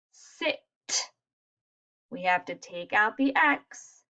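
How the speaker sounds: normal talking voice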